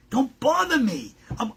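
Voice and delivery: ordinary talk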